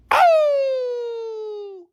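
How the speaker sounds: long, high imitated dog howl; high-pitched hound's howl, drawn out. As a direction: howl like a dog, sliding slowly down. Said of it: "the dog is howling and crying again".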